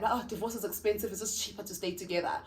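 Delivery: quietly imitating women who stay for the money; mock quote of resigned women. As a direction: murmur it the way those women say it, half mocking. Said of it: "Even women will say that".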